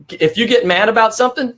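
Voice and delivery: ordinary talk, nothing marked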